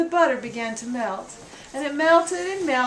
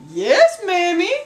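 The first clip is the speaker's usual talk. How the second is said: put-on boy's reply; 6 semitones higher, 7 dB louder, slower than usual. Boy answers meekly.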